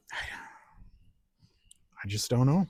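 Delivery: whispered to himself; whispering. Real, not acted. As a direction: breathe it out in a whisper. Frustrated mutter to himself.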